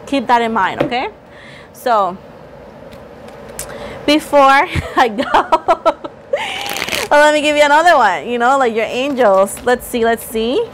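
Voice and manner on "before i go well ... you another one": laughing excited. Real, not acted.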